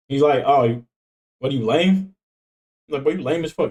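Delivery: ordinary talk, nothing marked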